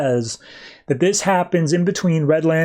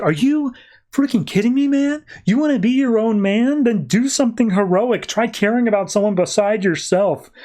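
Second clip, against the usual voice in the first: higher, exasperated telling-off; mock outraged scolding; 8 semitones higher than usual. Serafina to Mark, scolding him for refusing to help, in mock outrage. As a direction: berate him in disbelief.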